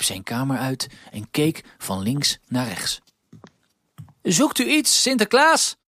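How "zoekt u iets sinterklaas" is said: high, eager helper voice; high Huispiet voice. A narrator's reading. Huispiet asks Sinterklaas, high and eager. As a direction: pitch way up for the little Piet.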